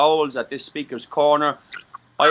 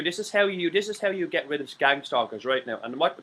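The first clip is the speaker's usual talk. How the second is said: usual speaking voice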